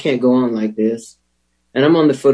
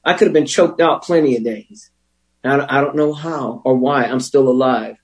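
ordinary delivery

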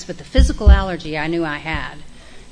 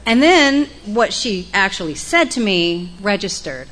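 normal talking voice

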